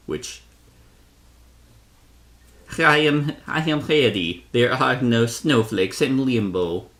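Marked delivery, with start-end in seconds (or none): 2.8-6.9 hammed-up Russian accent, pitched up; mock Russian character voice. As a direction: put on a shaky attempt at a Russian accent. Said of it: "a Russian accent?".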